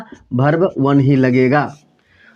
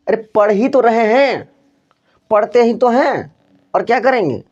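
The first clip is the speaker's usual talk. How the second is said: higher, insistent stress on ही तो, as if to say I am already studying; emphatic retort; 5 semitones higher than usual. Shown as a demonstration. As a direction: push ही तो hard with an exasperated lift. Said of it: "जोर दे करके जो बोलते हैं". (ज़ोर देकर, झुंझलाकर जवाब)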